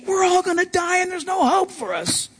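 high panicky voice, lightly played; panicked disciples. Preacher voicing panicked disciples, high and mock-frightened. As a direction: squeal it like doomed sailors.

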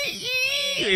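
high squeaky balloon noise; balloon squeak. Retold or imitated. Imitating Pee Wee's balloon for cohosts, high squeak. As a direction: squeal like a balloon letting air out. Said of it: "It's like Pee Wee Herman with the balloon".

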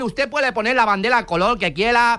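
Spanish in a put-on Chinese accent, Ls for Rs; mock Chinese accent. Fake clerk in China confirming the purchase to a customer, mock Chinese accent. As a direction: play a caricature Chinese clerk.

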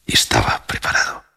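hushed, ominous whisper. A narrator's reading. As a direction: drop to a breathy whisper. Dramatic closing line whispered.

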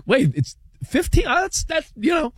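high-pitched, halting disbelief; acted surprise. Reacting to buddy's score update, surprised. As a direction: replay the double take, voice jumping up. Reliving news of the closing score.

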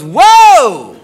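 loud, swooping mock whoa; mock startled cry. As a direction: act out her loud startled reaction. Girlfriend's startled reaction, retold.

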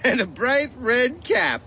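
high-pitched, teasing giggle; giggling brother. A narrator's reading. Brother teasing about the gift, high and giggly. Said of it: "giggled Freddie".

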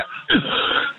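real dry coughs into the phone; coughing. Real, not acted. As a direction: clear the throat with a hard cough. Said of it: "I didn't mean to cough on the phone".

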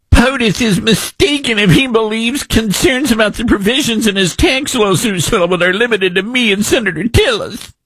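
high, whining mockery of the post; mocking senator's post in a high whine; whiny mock read. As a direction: read it like a sulky child. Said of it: "What a whiny little".

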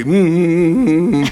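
high hummed mute grunts; mute grunting. Retold or imitated. Boy in a mute role grunting at the family, high hums. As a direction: grunt like a servant who cannot speak.

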